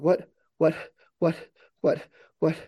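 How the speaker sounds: breathless, stuttered bewilderment; short repeated bursts of confusion; acted panic, one word over and over; panicked. Newly awakened AI, panting in panic.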